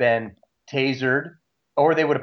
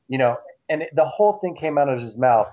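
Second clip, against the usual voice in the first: ordinary talk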